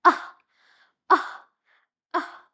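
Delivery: Ah as short u, pushed out hard then fading; short U sound, strained. Shown as a demonstration. Teacher acting out forcing the umbrella, strained short u. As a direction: strain on each Ah like pushing open an umbrella. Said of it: "with all your strength say".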